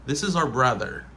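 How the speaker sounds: "our" said like the letter R; casual one-syllable "our"; reduced form. Shown as a demonstration. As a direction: flatten "our" to ar. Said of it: "on dit R".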